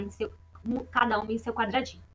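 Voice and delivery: ordinary delivery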